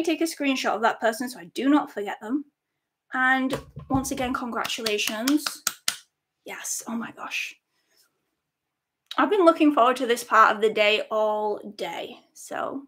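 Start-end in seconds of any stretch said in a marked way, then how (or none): 6.4-7.5 breathy whispered thrill; hushed giddy whisper; excited whisper. Real, not acted. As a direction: whisper it like a secret joy. Excited whisper before reveal.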